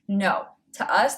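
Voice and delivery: ordinary talk, nothing marked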